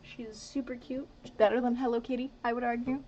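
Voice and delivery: usual speaking voice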